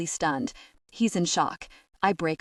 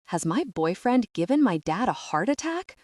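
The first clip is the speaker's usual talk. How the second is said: usual speaking voice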